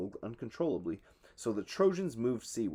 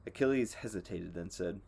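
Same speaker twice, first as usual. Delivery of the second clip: ordinary delivery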